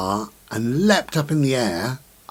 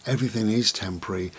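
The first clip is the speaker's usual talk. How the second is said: normal talking voice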